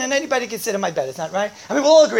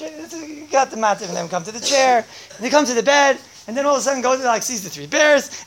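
usual speaking voice

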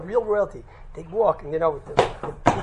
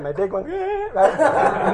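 usual speaking voice

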